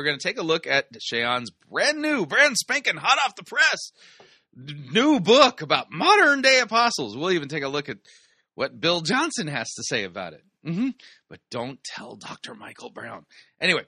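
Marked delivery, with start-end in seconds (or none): 1.7-7.2 mock hype. Mock hype for new book. 11.3-13.2 softer aside. Pretend. Conspiratorial aside.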